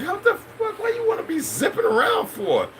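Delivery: a high falsetto gasp of disbelief at the coffee; shocked disbelief. Retold or imitated. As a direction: squeal it like you can't believe your ears. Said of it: "I was like".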